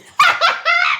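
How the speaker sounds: high loud cracking laugh; shrieking laugh at own silly voice; loud burst of laughter. Real, not acted. Laughs at own odd reading.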